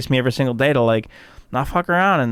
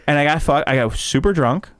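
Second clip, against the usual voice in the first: ordinary talk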